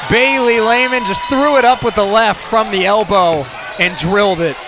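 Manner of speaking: excited play-by-play. Real, not acted. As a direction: call the scoring burst with energy. Live play-by-play call.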